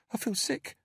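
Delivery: put-on high, small frightened child; timid little girl pleading; scared little girl. A narrator's reading. Narrator voices Bella.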